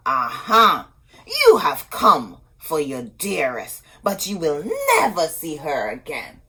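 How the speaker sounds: loud triumphant cackling taunt; gloating witch. A narrator's reading. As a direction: gloat over the trapped prince. Sorceress traps the prince.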